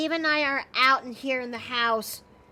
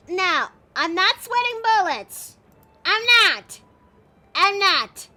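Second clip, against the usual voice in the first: nervous denial; 4 semitones higher, 7 dB louder, slower than usual. Real, not acted.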